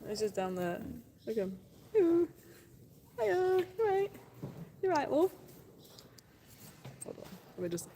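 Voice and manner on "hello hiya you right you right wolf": high-pitched, singsong hello; pet greeting. Real, not acted. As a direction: greet an animal in a raised little voice. Greeting an animal.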